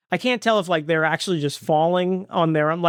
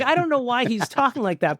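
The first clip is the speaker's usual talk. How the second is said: ordinary talk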